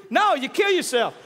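ordinary talk, nothing marked

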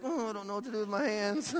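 high-pitched, awkward newcomer; mock awkwardness.

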